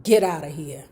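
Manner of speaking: low, menacing command; menacing order. Acted. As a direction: threaten the girl off. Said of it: "stepped towards me menacingly".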